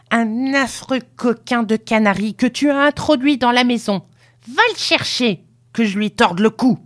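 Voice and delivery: high-pitched, scolding tirade; angry old woman. A narrator's reading. Grandmother threatens the canary, scolding angrily.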